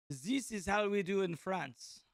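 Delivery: slow, hammed-up French accent; terrible French accent. Commentator jokes about how they do in France, bad French accent. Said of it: "probably a terrible French ac-, French accent".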